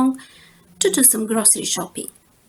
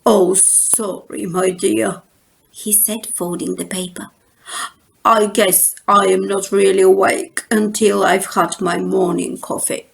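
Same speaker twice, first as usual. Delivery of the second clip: sleepy papa; 3 semitones lower than usual. Acted. Groggy Papa apologizes.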